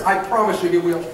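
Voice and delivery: normal talking voice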